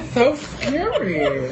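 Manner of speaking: high, very slow mock dread; playful fright. Reacts to the child's doll play, mock dread. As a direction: drag it out high through a laugh.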